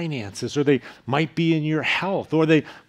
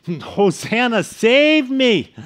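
desperate cry; 8 semitones higher, 6 dB louder, slower than usual. Acted. Voicing the sinner's cry.

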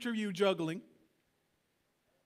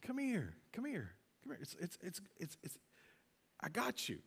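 tender hushed call; 10 dB quieter than usual. Retold or imitated. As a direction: coax gently, almost hushed. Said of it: "I hear that in the tone of God".

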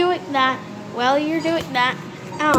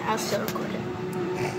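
nervous night vlog whisper; 3 semitones lower, 7 dB quieter, slower than usual. Real, not acted. Quiet aside while filming at night.

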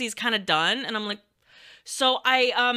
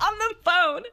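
ordinary talk

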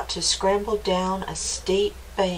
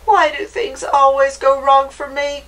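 higher, louder mouse grumble; grumbling mouse; 5 semitones higher, 5 dB louder than usual. A narrator's reading. Mouse stuck in a dark hole, high loud grumbling. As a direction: grumble as a put-upon little mouse, pitched up. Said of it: "grumbled the mouse".

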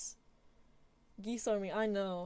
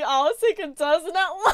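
louder, breaking into laughter mid-line; laughing boast; 7 semitones higher, 9 dB louder than usual. Real, not acted. Streamer boasting to chat about geese, laughing. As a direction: brag through giggles.

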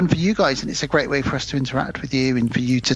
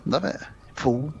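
ordinary talk

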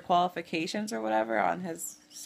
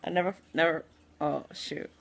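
usual speaking voice

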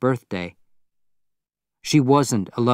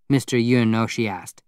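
plain reading voice

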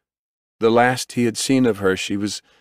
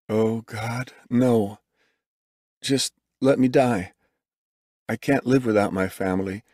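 despairing; 3 semitones higher than usual. A narrator's reading. Despairing father begs to die.

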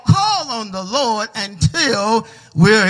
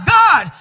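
"God" shouted high; preacher hits "God" hard against "We give"; preaching emphasis; 9 semitones higher, 5 dB louder than usual. Acted. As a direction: punch "God" as the turn. Sermon contrast climax.

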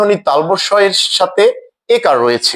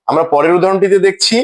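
ordinary talk, nothing marked

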